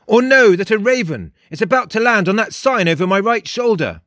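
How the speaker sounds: high, emphatic self-assured character; cocky high character voice; boastful Frost. A narrator's reading. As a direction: boast grandly, pitched well up. Frost boasts of his powers.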